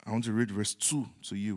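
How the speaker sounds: ordinary talk, nothing marked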